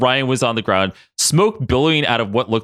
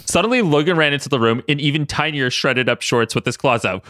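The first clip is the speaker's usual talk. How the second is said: usual speaking voice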